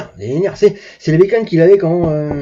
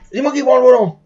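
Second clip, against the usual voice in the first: raised, higher-pitched declaration; imitated bold announcement; 6 semitones higher, 4 dB louder than usual. Manu insists to his mate on driving, imitated bold announcement. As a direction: claim the wheel, a notch up.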